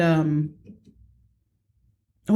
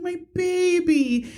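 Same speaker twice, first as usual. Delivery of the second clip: tender cooing; 12 semitones higher, slower than usual. Retold or imitated. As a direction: melt over your baby, drawn out. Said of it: "I held her 'cause I was like".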